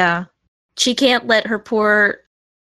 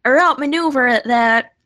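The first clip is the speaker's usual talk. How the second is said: acted alarm; 9 semitones higher than usual. Player voicing her character about being outrun, acted alarm. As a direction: raise the pitch sharply, as if alarmed. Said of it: "she's gonna be like".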